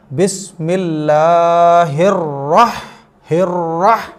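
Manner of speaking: slow, with rolled r and breathy h in रह-; correct tajweed recitation. Shown as a demonstration. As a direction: stretch बिस्मिल्लाहिर, land hard on रह-. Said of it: "इसमें गलती क्या करते हैं लोग?". (रह- में रा मोटा, बड़ी हा)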